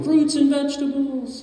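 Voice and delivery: very high, held-out chanting call; imitated vendor shout. Vendor goes by announcing their presence, mock shout. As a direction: sing it out high like a street seller. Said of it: "and they're shouting".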